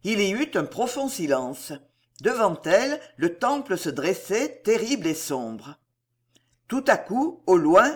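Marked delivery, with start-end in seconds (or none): none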